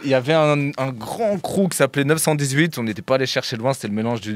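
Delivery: ordinary talk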